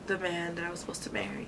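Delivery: fast whispered confession; whispering. Real, not acted. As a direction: hush it like a secret. Whispered aside at story end.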